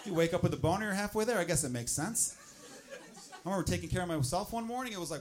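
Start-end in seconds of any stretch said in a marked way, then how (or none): none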